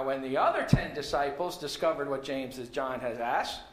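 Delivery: ordinary delivery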